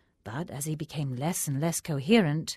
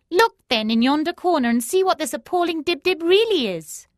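higher, louder contemptuous screech; screeching hag; 13 semitones higher, 9 dB louder than usual. A narrator's reading. Hag scorns the cowering Faki, screeching. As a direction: spit it at the foolish sage. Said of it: "screeched the hag".

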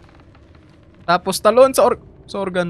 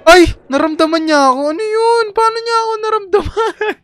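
high, startled gasp of alarm; alarmed surprise; 8 dB louder than usual. Real, not acted. Player startled when the enemy senses her, alarmed. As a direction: caught off guard, voice jumping up.

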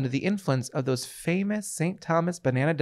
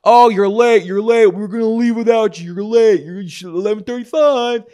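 loud, higher-pitched mock heckling; scolding crowd; 5 semitones higher, 8 dB louder than usual. Passengers heckling the latecomer, loud mock scolding. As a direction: pile on noisily as annoyed fellow passengers.

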